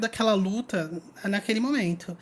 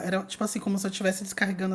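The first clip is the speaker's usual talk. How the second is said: normal talking voice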